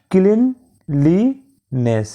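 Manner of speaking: ক্লিনলিনেস said very slowly as klin-li-ness; teacher spelling out each syllable of ক্লিনলিনেস; split into syllables. Shown as a demonstration. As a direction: break ক্লিনলিনেস into three clear beats. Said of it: "এটি তিনটি অংশে ভেঙে ফেলি".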